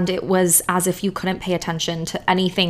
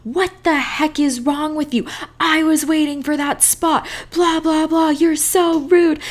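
higher, mock-angry yell at a stranger; an angry man scolding her over a parking spot, higher mock yell; retold angry yelling; 9 semitones higher than usual. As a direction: play the grumpy old man yelling at her, a little hammed up. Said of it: "this older man starts immediately screaming".

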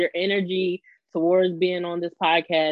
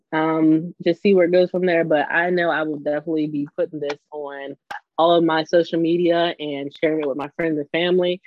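ordinary delivery

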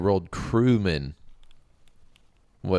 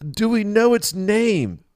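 eager high priest; 11 semitones higher, 7 dB louder than usual. Acted. Priest calls after leaving king.

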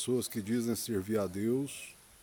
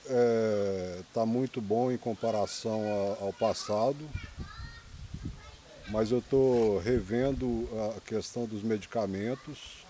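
usual speaking voice

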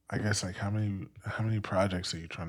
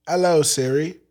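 raised, clipped posh British hello; mock British accent; 7 semitones higher, 10 dB louder than usual. Friend urged him, so a mock British greeting. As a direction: put on a plummy British voice to wake the phone. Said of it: "you should put a British accent on".

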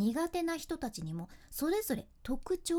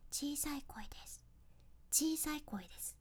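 soft breathy whisper, barely voiced; small whisper; 3 semitones higher, 10 dB quieter than usual. Shown as a demonstration. Teacher demonstrating the small voice to learners in a whisper. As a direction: whisper it as if leaning in close. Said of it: "イメージとしてはささやく感じね".

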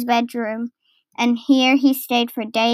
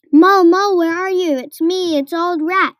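raised, higher-pitched call; 6 semitones higher than usual. A narrator's reading. Rat calls for lost Mole in the wood, calling out. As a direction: call out to find someone lost.

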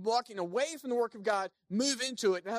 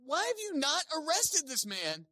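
slow, high indignant demand; angry Pharisees; 9 semitones higher, 4 dB louder than usual. Retold or imitated. Pharisees scolding officers, high and slow.

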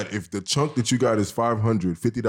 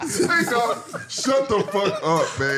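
high laughing shut-up; 8 semitones higher, slower than usual. Real, not acted. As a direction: laugh through the scolding. Laughing while telling him off.

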